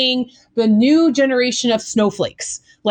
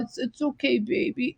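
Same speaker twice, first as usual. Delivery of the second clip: much softer, mock-soothing coo; coddling parent; 10 dB quieter than usual. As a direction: mimic a parent cooing to a child. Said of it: "Stop telling your child".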